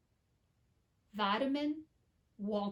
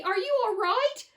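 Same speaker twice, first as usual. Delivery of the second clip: high, loud anxious plea; a frightened mother checking on her son; worried cry; 8 semitones higher, 6 dB louder than usual. A narrator's reading. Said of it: "cried Missus T V".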